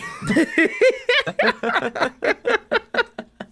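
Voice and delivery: high, loud helpless squealing laugh; real burst of laughter. Real, not acted. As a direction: break up laughing at your own dark joke. Joke about flying into building.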